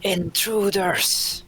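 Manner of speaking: shouting dragon, long vowels; dragon's shout. Acted. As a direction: bellow it at trespassers, stretching each syllable. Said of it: "just shouts back".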